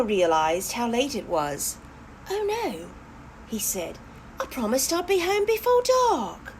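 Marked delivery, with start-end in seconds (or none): 2.3-2.9 put-on high young hare's worried cry; hare's dismay. Hopper to his friend, a high, worried little hare. 4.4-6.5 put-on high young hare's worried cry; hare's dismay. Hopper to his friend, a high, worried little hare.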